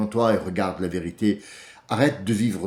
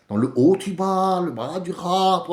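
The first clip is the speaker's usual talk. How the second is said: mock Alsatian accent, pitched up; 8 semitones higher, 3 dB louder than usual. Speaker mimicking Alsatians to defend regional accents, mock Alsatian accent. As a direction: imitate a sing-song Alsatian accent. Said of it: "ils ont un autre accent aussi".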